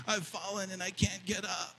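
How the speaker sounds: put-on a breathy laugh, then a soft, high, frail old man's cry for help; hammed-up helpless old man, quiet and high; mock frail old voice. Quoting the old TV ad.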